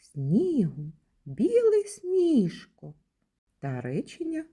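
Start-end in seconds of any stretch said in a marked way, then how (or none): 0.0-2.9 high, slow, joyful call to the snow; teacher showing an exclamation to children; exclamatory line. Shown as a demonstration. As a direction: exclaim it with delight. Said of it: "Які речення у нашому віршику читаються з особливим відчуттям".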